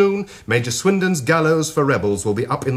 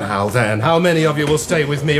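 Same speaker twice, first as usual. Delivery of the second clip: ordinary delivery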